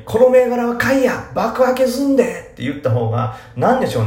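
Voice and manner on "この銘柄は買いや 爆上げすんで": put-on much higher, louder cocky Kansai accent; boss-like Osaka tip; Kansai hype. Podcaster imitates a Kansai poster, cocky and loud. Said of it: "っていうよりも本当に".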